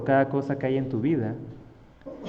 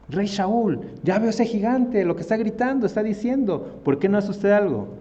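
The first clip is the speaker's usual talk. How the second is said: high, louder urgent appeal to a king; apremio indignado; 8 semitones higher, 4 dB louder than usual. Acted. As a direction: press the king to act, pitched right up. Acted David urging the king. (súplica indignada y aguda al rey)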